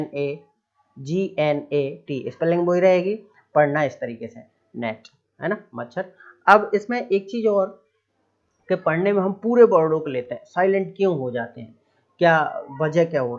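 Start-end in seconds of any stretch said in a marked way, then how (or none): none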